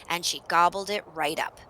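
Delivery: usual speaking voice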